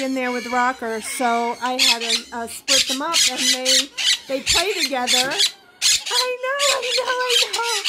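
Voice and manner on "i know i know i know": pet-talk cooing. Real, not acted. Owner answering her excited bird, cooing. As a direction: coo it high and indulgently.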